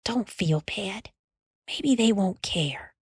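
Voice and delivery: soft, slow comforting hush; a sister's quiet comfort; a little sister soothing her worried brother; whispered. A narrator's reading. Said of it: "Alice whispered to him".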